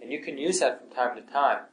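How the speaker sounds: ordinary talk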